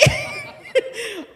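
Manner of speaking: high, loud delighted laugh; real laughter. Real, not acted. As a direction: let the laugh burst out. Laughs after retold okay.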